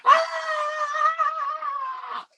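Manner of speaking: long, very high-pitched mock scream; imitated loud scream. Kevin screams so all New York hears, imitated high scream. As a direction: hold one piercingly high "Ah" like a panicking movie kid. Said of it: "Kevin starts screaming ... the loud ass scream".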